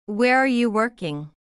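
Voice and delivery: usual speaking voice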